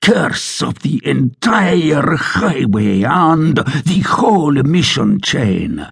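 roared. Acted. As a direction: bellow it at the landlord, slowly. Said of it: "Sergeant Gonzalez roared".

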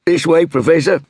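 lightly voiced inspector introducing himself; inspector's voice. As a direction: play the self-assured Scotland Yard inspector. Inspector leading the way.

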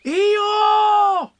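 very high, loud drawn-out shout; high-pitched whoop of surprise; astonished cry. A narrator's reading. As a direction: cry out the name in shrieking amazement. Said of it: "cried everybody".

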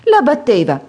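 grido alto e forte di spavento; grido allarmato. A narrator's reading. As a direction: urla la notizia sconvolta. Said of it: "gridò la madre di Edith". (la madre grida allarmata, alta e forte)

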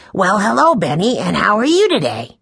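sing-song child's imitation of a hearty greeting; boy mimicking friendly grandfather. A narrator's reading. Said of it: "He always says".

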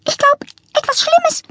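very high, worried little peep; peeping bird. A narrator's reading. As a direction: squeak it like a scared bird. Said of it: "piepste der Grünling".

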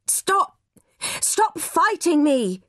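very high, slow cry of stop; desperate shout. A narrator's reading. Mother yelling while wrestling her son's wrists. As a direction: yell to make him stop fighting.

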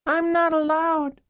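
put-on very high, slow little-girl voice; young girl. Girl answering caseworkers through the door, young child voice. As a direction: answer in a tiny child's pitch, drawn out.

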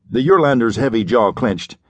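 usual speaking voice

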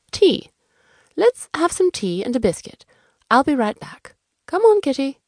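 high, lively host offering tea; eager fussy host. Acted. Host calling the cat along to fetch tea, eager. Whimsy voiced in the story.

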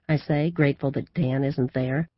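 plain reading voice

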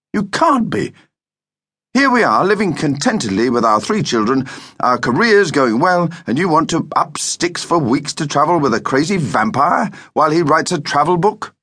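high-pitched, exasperated disbelief; incredulous protest. A narrator's reading. Husband protests wife's plan to travel, high incredulous outburst. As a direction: argue it high and emphatic.

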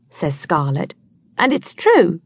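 plain reading voice

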